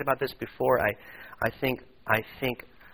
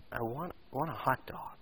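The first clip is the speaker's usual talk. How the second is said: fearful desperation; 8 dB quieter than usual. Acted. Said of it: "with a kind of fearful desperation".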